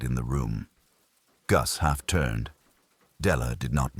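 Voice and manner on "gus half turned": plain reading voice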